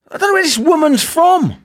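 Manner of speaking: fed-up yell; exasperated shout. Real, not acted. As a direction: throw hands up vocally. Exasperated outburst.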